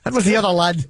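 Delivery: a playful Irish brogue on lad; Irish accent. Retold or imitated. Imitating the old Irish actor denying it, in a light Irish accent. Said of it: "That's my Irish accent".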